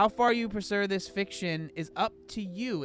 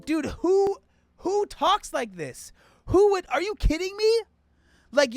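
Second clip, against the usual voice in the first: much higher and louder, disbelieving outburst; you can't believe anyone talks like this; incredulous; 13 semitones higher, 7 dB louder than usual. Real, not acted. Creator ranting at the advice book, incredulous high outburst. Mocking the guide's suggested dialogue.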